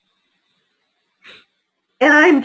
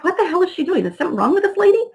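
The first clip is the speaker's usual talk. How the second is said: higher, baffled inner voice; incredulous; 7 semitones higher, faster than usual. Retold or imitated. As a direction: disbelief at the guard. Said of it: "I'm like".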